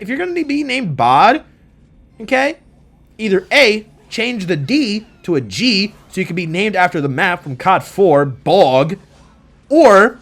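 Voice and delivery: high, loud mock lecture; heated roast. Real, not acted. Gamer roasting opponent's name to viewers, high and loud. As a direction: scold the name with gusto.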